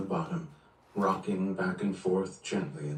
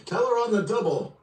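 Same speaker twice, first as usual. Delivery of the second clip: high, loud barked command; shouted order; 13 semitones higher, 6 dB louder than usual. Acted. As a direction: yell the order across the room. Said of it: "Barnes shouted".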